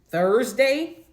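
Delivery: indignant, questioning disbelief; incredulous question. Real, not acted. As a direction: throw the day back as an outraged question. Indignant repeat of his offer.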